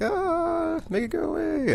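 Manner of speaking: very high mock shriek; squealed, hammed-up panic in a tiny high voice; freaking out. Rogue begging the voices to go away, freaking out. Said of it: "Rogue is just freaking out".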